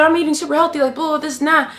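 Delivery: lightly voiced diet boaster. Imitating women who claim healthy eating, mock boast.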